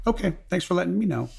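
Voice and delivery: high, breezy mock brush-off; mock casual sergeant. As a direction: toss it off lightly like an unbothered sergeant. Watch sergeant's casual reply, retold.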